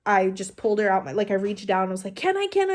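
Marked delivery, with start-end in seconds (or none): 2.2-2.7 high-pitched begging for permission; pleading, pitched way up; retold eager plea. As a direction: act out an impatient, eager request. Retold eager plea to hold baby.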